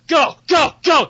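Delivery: high-pitched rallying shout; urgent shouted command. Acted. Dwarf ordering his squad into the water, urgent shout. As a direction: yell them over the edge. Dwarves' panicked order to jump.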